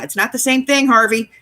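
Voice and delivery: high, insistent retold objection; exasperated. Retold quarrel with Harvey.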